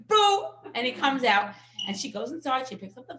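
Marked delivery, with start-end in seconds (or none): 0.1-0.5 high, loud playful boo; child's boo. Retold or imitated. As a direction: jump out to scare mommy. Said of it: "and he says".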